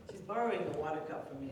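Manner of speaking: normal talking voice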